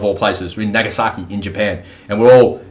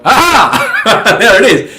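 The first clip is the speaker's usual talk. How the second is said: realization; 11 semitones higher, 6 dB louder, much slower than usual. Retold or imitated. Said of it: "And I thought".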